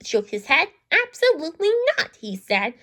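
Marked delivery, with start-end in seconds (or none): 0.9-2.2 high, quick and emphatic; firm refusal. A narrator's reading. Boober refusing Wembley, quick and emphatic. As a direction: snap back a flat no.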